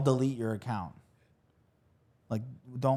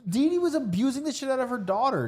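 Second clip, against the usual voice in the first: much higher and louder, worked up; heated outburst; 14 semitones higher, 5 dB louder than usual. Real, not acted. Arguing with friend about Dee Dee, high and indignant. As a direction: push it out high in disbelief.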